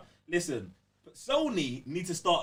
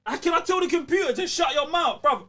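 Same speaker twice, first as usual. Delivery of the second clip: high, loud joking yell at a friend; mock outraged shout; 12 semitones higher, 9 dB louder than usual. Real, not acted. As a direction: yell in fake fury at your mate.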